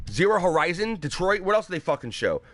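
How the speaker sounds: ordinary talk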